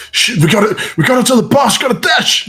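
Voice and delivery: high, urgent cry; panicked bandits. Acted. Two bandits fleeing to warn their boss, panicked high voice. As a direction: blurt it out in a high mock panic. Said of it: "look around and say".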